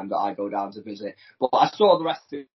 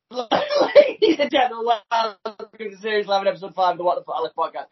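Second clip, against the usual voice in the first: announcer intro; 9 semitones higher, 6 dB louder than usual. Acted. Host opening the episode, hammed-up announcer voice. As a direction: announce the show like a ringmaster.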